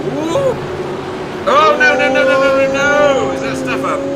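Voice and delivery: high-pitched rising "oh no"; alarmed excitement. Real, not acted. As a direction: yelp in alarm as the car spins. Crash seen during live race.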